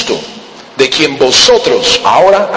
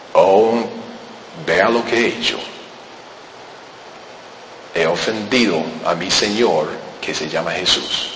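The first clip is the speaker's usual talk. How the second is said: remorseful confession; 8 semitones lower, 10 dB quieter than usual. Acted. Saul's remorse voiced in sermon.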